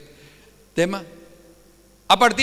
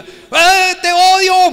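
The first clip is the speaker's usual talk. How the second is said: screaming curses, high and loud; furious shout; 9 semitones higher, 12 dB louder, much slower than usual. Retold or imitated. Preacher voicing a madman cursing the fleeing king, furious shouting. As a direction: hurl the insults at him full force. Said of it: "un loco que le grita en el camino".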